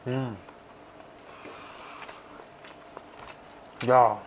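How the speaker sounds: chewing while speaking; muffled by food; mouth full of sushi. Real, not acted. Seen 1: Chews with closed, full mouth 0–4.8s; later speech looks clear.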